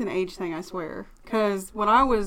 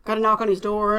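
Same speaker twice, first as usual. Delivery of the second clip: usual speaking voice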